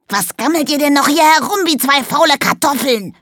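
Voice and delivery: hohe, quäkende Koboldstimme; quäkend. Acted. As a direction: frech quäken wie ein Kobold. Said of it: "quäkte Fitz". (quäkende Koboldstimme)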